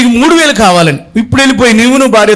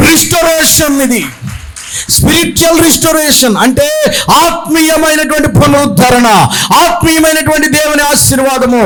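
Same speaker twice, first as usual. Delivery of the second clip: fiery preaching; 5 semitones higher than usual. Acted. Preacher proclaiming the prophecy to the congregation, fiery and shouted.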